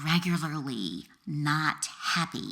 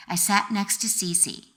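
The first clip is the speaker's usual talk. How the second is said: ordinary delivery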